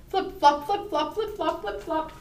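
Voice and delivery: high, punchy run of flip-flops; hurried footsteps. A narrator's reading. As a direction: rattle off the goose's scurrying feet. Goose hurries back home.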